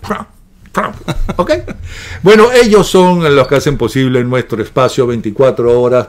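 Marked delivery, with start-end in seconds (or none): 0.1-1.0 "Trump" copied as a short "tramp"; short repeated Trump, practising the English vowel; imitated pronunciation. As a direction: try out Trump with an open A. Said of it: "A ver, ¿cómo?".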